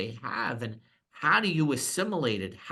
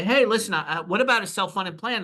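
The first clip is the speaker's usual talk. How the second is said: higher, louder eager sales pitch; mock salesman pitch; 8 semitones higher, 4 dB louder than usual. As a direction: play an overeager broker. Imagined pitch to a client.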